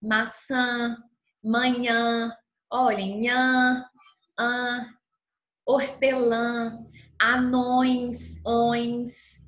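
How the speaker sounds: "Maçã", "manhã" with nasal ã, slow; nasal word demo. Shown as a demonstration. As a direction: press the nasal vowels. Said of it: "É um som nasal".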